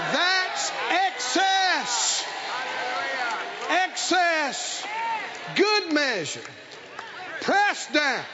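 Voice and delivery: slow, ringing proclamation with stretched words; emphatic preacher pausing after each phrase; fervent preaching. Acted. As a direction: build the sermon to a drawn-out peak. Preaches at full force.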